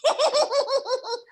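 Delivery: high-pitched staccato giggle in character; character laugh. Shown as a demonstration. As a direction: giggle high and rapid, like a tiny cartoon villain. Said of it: "she would laugh like this".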